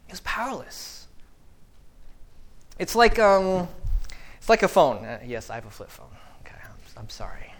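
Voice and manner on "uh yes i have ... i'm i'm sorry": whispered aside to the congregation. Real, not acted. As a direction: drop to a whisper for a quick aside. Aside about his flip phone.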